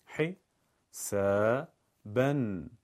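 ح، س، بن split into separate syllables with pauses. Shown as a demonstration. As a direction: spell out ح، س، بن one syllable at a time. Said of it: "بخشخوانی کلمات قرآن".

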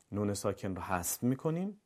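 ordinary talk, nothing marked

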